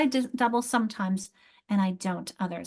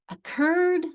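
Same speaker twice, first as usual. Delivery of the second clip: "Occurred" with a high CURRED; "Occurred", strong raised second syllable; final-syllable stress; 7 semitones higher than usual. Shown as a demonstration. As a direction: lift the end of "Occurred": o-CURRED. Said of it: "final syllable is stressed".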